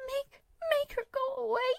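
high, crying terror; begging in terror; terrified plea. A narrator's reading.

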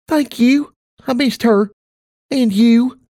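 put-on high, grateful child's voice; a small girl saying thanks; little girl voice. Child thanks her mother.

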